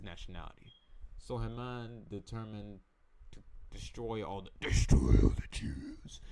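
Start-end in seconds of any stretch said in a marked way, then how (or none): 4.6-6.2 slow, very low, loud rasped whisper; villainous growl. Acted. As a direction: growl it slowly like a cartoon villain. Voicing Haman's murderous resolve.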